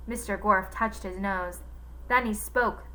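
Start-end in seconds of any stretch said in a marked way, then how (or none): none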